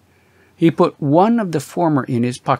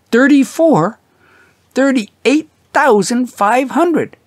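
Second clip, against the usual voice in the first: much higher, excited and breathless; wife marvelling at the sudden fortune; Mary, thrilled; 8 semitones higher, 3 dB louder than usual. A narrator's reading. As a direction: rattle it off in giddy wonder. Mary amazed at the money.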